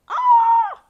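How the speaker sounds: a high, loud yelp of pain; sudden pained shout. Acted. Boy hit by a soccer ball yelps loudly. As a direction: cry out as the ball hits you.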